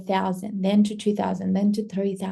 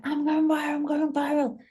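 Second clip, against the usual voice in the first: higher-pitched excited chant; excited boast; 6 semitones higher than usual. Retold or imitated. Creator retelling her own hype about going viral, excited. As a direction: chant it high, hyping yourself up.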